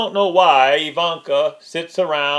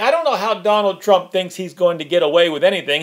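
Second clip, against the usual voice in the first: usual speaking voice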